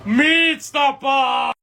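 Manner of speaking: low, slow, booming Meat Stopper line; deep slow catchphrase. Acted. Big guy booms his catchphrase at the crew. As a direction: announce yourself like a heavy brute.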